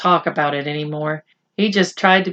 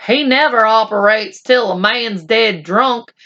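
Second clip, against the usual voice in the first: Boone the cowboy; 5 semitones higher than usual. Acted. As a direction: spin a tall tale like an old cowboy. Boone boasts about the doctor.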